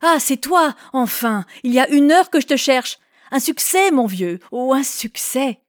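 high, jubilant calling out; excited shout. A narrator's reading. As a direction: burst in with triumphant news. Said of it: "il cria". (cri exalté, bien plus aigu)

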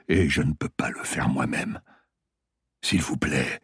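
lightly voiced dying patient, low and faint; a hint of a weak old patient; dying man voice.

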